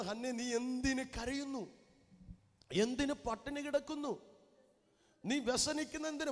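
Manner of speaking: high, loud preacher's voicing of the husband; fervent husband asking his wife why she weeps; Elkanah asking. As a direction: cry out the husband's questions. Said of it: "Elkanah പറയാ".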